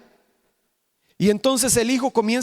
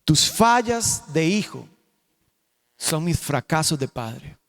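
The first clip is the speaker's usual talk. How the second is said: solemn slow repeat; 6 semitones lower, slower than usual. Shown as a demonstration. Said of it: "repeated for weight". (repetición lenta y solemne, más suave)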